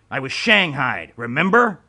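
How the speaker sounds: loud bitter jab; loud, resentful reminder; angry retort. A narrator's reading. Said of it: "Remo snapped".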